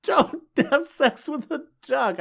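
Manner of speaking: high, slow mock plea; high-pitched exclamation. Podcaster repeating the joke warning, high-pitched mock exasperation. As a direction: draw each word out up high.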